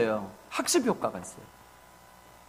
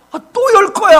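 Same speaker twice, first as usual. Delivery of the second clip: wife's excuse; 10 semitones higher, 6 dB louder than usual. Retold or imitated.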